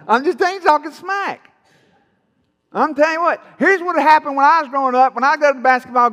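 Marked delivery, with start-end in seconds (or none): 0.1-1.5 high-pitched trash talk; trash-talking Elijah. Acted. Elijah taunting his rivals, playful smack talk. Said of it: "Elijah's going".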